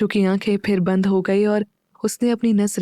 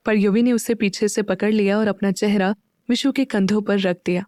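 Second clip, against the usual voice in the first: ordinary delivery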